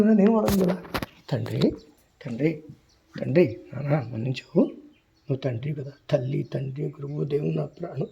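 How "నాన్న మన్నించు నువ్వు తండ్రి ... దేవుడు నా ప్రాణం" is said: ordinary talk, nothing marked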